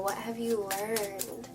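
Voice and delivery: ordinary talk, nothing marked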